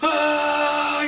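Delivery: very high, loud mock yell; recruit's battle yell. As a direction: let out a hammed-up war cry while gearing up. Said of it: "he starts yelling".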